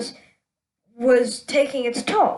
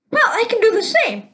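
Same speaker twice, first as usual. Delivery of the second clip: light Russian voice; 9 semitones higher than usual. Acted. Russian character answers.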